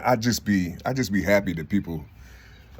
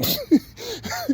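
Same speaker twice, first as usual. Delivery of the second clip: a high, squealing burst of laughter. Real, not acted. Laughing high to viewers at his own rant. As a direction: crack up high in the middle of the rant.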